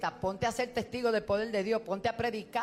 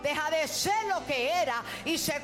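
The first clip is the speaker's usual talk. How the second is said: rising, slowing into a shouted climax; building sermon to a shouted peak; full-force preaching; 5 semitones higher than usual. Acted. Preaching climb to a shouted close.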